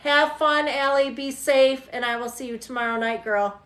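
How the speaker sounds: usual speaking voice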